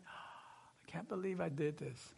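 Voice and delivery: soft, whispered self-reproach; dismayed whisper. Retold or imitated. Pastor realizing he left his friend behind, whispering. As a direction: breathe it out, ashamed of yourself. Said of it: "I'm like".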